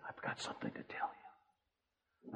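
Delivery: hushed and slow, for effect; dramatic whisper. Retold or imitated. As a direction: whisper it like a big secret. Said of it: "I'd use a real dramatic voice".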